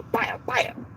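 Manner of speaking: two quick high popped bangs; imitated gunshots. Officer shoots her, imitated gunfire. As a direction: mimic shots without raising the volume.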